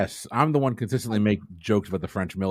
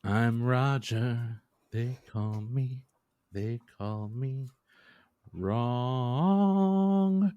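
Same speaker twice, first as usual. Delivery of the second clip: surfer dude voice; 3 semitones higher, much slower than usual. Acted. Playing Roger the surfer.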